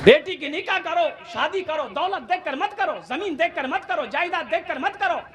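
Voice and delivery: high, driving preacher's commands; impassioned exhortation. Acted. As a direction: urge the crowd in repeated ringing phrases. (ऊँचे सुर में जोशीली नसीहत)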